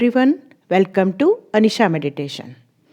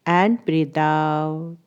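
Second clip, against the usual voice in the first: guided meditation; 2 semitones lower than usual. Acted. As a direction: ease them into stillness, drawing out the English cues. (धीमी, ठहरी हुई ध्यान कराने वाली आवाज़)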